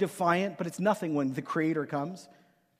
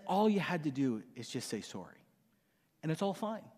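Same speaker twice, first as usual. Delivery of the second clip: reassuring; 3 semitones lower, 9 dB quieter than usual. Retold or imitated. Dad reassuring son after hug.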